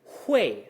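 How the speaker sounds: loud, steep fall on "Hui". Shown as a demonstration. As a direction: let "Hui" land at the bottom of the register. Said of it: "resolves pretty much at the bottom".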